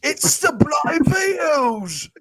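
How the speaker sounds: high, slow shouted British phrase; mock British accent. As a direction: belt out the only British line she can do. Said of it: "that's all I can do".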